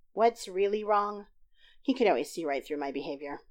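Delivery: usual speaking voice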